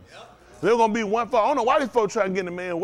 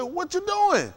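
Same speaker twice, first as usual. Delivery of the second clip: much higher startled yelp of a question; shocked; 11 semitones higher, much slower than usual. Retold or imitated. Preacher retelling finding his truck wrecked, much higher and shocked. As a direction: jump up in disbelief. Shocked at friend in car wash.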